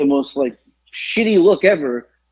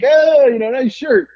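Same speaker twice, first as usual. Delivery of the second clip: high, louder opening as a passer-by calls out; mock enthusiastic greeting; 10 semitones higher, 4 dB louder, slower than usual. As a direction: imitate a stranger hailing you. Voicing passers-by giving a nod.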